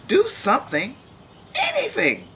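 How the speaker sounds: frantic demand for action at the meeting; desperate plea. Acted. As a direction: beg them to act, voice cracking upward. Berry pleads at meeting.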